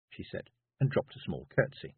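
ordinary delivery, nothing marked

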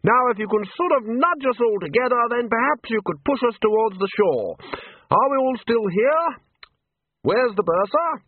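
high, projected calling voice; high-pitched, bossy hailing; Ridcully, loud. A narrator's reading. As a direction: call instructions heartily across a distance. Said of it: "Ridcully continued loudly".

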